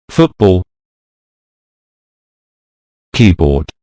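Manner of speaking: FOOTball, KEYboard, each stressed on the first part; stress on first noun. Shown as a demonstration. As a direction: stress foot in football and key in keyboard, second part lighter. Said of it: "the word stress is on the first noun".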